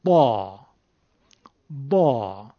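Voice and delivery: "با" as a thick, heavy d, falling low; heavy Egyptian Dad. Shown as a demonstration. Teacher showing students today's common Dad, heavy d. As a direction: say "با" like an emphatic, thickened d. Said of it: "و متداولش چیه؟".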